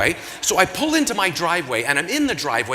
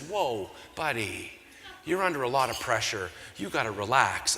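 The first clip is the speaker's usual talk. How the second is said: calming; 5 semitones lower, 6 dB quieter than usual. Acted. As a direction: ease him down gently, unhurried. Stranger calming a dazed man.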